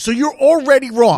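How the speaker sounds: loud, high, accusing. Real, not acted. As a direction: hammer it home that they're wrong. Heated rant at scientists.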